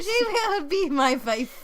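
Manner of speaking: high, loud, slow fake German-French accent; mock a bad French accent that sounds German. Echoing co-host's actor impression, German-French accent. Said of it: "it's like German French".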